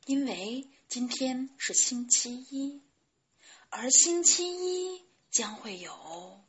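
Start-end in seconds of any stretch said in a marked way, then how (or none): none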